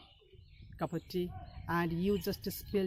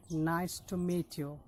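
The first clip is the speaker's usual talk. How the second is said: each word spaced out, slow; slow careful demo; slower than usual. Shown as a demonstration. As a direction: model it slowly for learners to repeat. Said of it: "if you say it faster".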